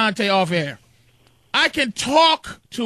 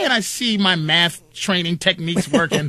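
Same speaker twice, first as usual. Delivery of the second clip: usual speaking voice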